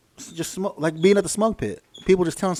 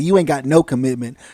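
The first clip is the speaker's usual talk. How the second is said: normal talking voice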